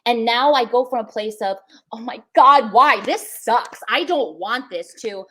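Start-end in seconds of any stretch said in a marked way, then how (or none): 1.9-4.9 a loud, exasperated groan at life; frustrated complaint. Retold or imitated. Speaker quoting her old self railing at hardship, loud and exasperated. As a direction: whine it out like everything is unfair.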